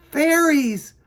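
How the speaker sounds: high, loud joyful cry; relieved cry. A narrator's reading. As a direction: burst out with happy relief. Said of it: "he buzzed with relief".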